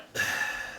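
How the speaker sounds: real, audible breathy sigh; exasperated sigh. Real, not acted. Player gets nothing from the local, exasperated sigh. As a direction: let out a sigh of frustration. Fruitless talk with a character.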